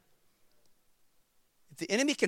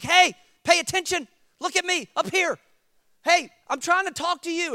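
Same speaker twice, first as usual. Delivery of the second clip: high, raised voice hailing a crowd; mock exasperated call; 12 semitones higher, 7 dB louder than usual. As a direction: act out demanding a room's attention. Calls to inattentive room in dream.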